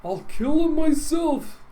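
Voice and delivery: high, loud furious yelling; a furious bettor yelling; angry fan yelling. Acted. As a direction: rage like a fan who lost his bet. Rioting fans yell at Teagle.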